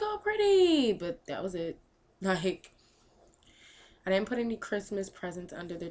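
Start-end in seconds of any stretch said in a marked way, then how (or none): 0.0-0.9 high, loud coo of delight; imitating excited daughter. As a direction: mimic a child's drawn-out, high-pitched awe. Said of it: "She was excited".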